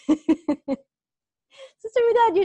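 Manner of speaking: teacher teasing a student, laughing. Real, not acted. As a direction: call her name while cracking up.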